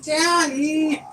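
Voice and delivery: wife voicing Oliver to her husband, a pleading daddy; voicing the dog. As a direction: speak for the dog begging daddy. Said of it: "Oliver's like".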